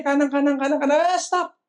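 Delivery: high, frantic coaching, climbing in pitch; imitated mother cheering. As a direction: urge on your blindfolded child, building to a squeal. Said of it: "pag narinig yung boses niya". (nanay na sabik na sumisigaw ng direksyon, pataas nang pataas)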